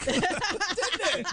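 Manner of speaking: high laughing question. Real, not acted. Laughing at sarcasm remark.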